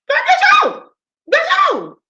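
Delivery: squeaky high mock blessing, shouted; hammed-up high beggar voice yelling God bless you; shrill mock beggar. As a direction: screech the blessing like a mocking parrot.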